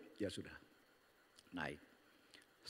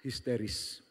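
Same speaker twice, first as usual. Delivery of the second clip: normal talking voice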